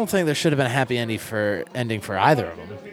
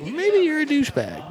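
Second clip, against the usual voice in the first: high-pitched mocking jab; mocking retort; 14 semitones higher than usual. Retort to people who mispronounce the surname, high mocking voice. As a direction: pitch it way up, teasing.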